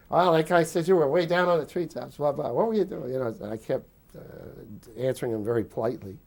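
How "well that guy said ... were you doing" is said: higher, louder retold accusation; imitating an instructor accusing the cadet; accusing interrogator. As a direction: throw the charge at him hard. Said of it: "they all asked me questions like".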